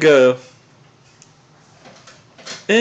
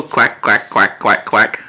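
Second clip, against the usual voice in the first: duck quacks; 7 semitones lower than usual. Retold or imitated. Parent asking the baby about ducks, lower mock quack.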